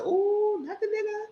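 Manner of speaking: very high falsetto gasp; mock scandalized squeal. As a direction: squeal it like a shocked auntie. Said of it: "I said".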